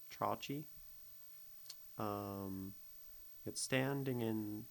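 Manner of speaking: ordinary talk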